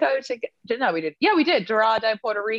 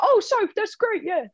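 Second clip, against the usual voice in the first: high gushing friend impression; a mock excited high voice; imitating James; 9 semitones higher than usual. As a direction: gush it up high like him. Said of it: "But me talks like this".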